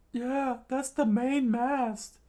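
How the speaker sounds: very high, slow falsetto; slow cartoon squeak; high-pitched crew voice. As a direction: squeak it out, drawing the words out. Acted crew member in the story.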